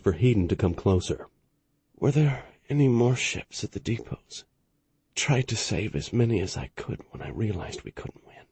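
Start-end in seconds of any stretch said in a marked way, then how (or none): 2.0-8.4 soft, strained, fading; dying man forcing out words; weak voice. A narrator's reading. Dying admiral speaks weakly.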